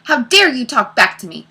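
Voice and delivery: snapping. A narrator's reading. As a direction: snap indignantly at a cheeky pupil.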